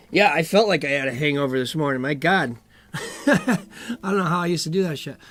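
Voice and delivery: ordinary talk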